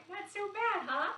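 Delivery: ordinary talk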